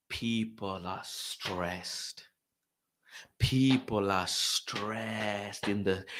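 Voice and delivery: very slow, low and heavy; drawn-out emphasis. Real, not acted. Fan describes stressed customers in queue, drawn-out. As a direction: stretch every word out wearily.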